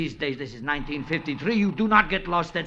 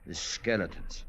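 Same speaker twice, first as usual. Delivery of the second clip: uneasy dread; 7 semitones lower, 5 dB quieter than usual. Acted. [name] uneasy about the skeletons.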